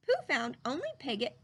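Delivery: ordinary delivery, nothing marked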